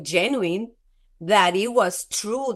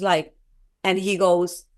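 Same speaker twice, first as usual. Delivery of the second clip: normal talking voice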